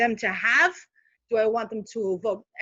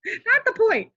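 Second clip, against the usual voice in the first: high-pitched, through real laughter; amused, voice jumping up with laughter; laughing; 11 semitones higher, 4 dB louder than usual. Real, not acted. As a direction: laugh it off as you say it. Laughs at own slip about scaring people.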